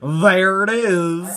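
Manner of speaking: put-on slow, drawled country accent "There it is.". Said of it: "in a country accent".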